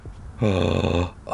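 gasp. Real, not acted. Reader gasping at the plot.

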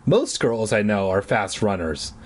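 ordinary talk

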